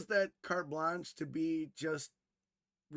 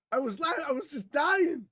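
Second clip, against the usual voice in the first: normal talking voice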